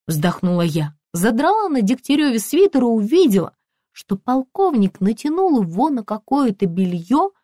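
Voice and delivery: usual speaking voice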